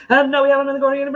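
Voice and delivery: horse racing announcer. Retold or imitated. Imitating the announcer that gets the dog worked up. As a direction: rattle it off like a race caller. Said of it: "like that announcer voice with the horse racing".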